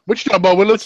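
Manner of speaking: lightly hammed-up sitcom puzzlement; mock catchphrase. Co-host riffing on a baffling remark, mock sitcom catchphrase. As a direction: toss it out as a TV catchphrase.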